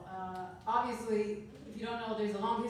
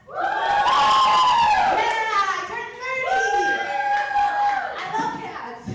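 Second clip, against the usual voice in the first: crowd cheer; 15 dB louder, much slower than usual. Real, not acted.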